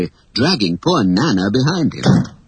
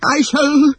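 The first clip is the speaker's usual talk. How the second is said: sputtering rage; 11 semitones higher than usual. Acted.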